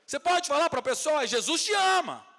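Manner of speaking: high, loud proclamation; full-force preaching. Real, not acted. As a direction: shout it out to the whole congregation. Preaching climax. (proclamação aos brados)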